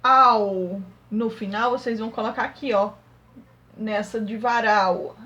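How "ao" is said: "ao" stretched long and louder; drawn-out demonstration of "ao"; ending sounded out. Shown as a demonstration. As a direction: hold "ao" as a slow, isolated sound. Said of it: "as palavras que tem o".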